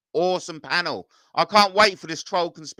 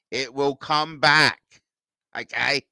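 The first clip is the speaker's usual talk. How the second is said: slow, drawn-out "It will come back"; slow reassurance. Real, not acted. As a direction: stretch out each word to reassure. Slow emphatic repeat of reassurance.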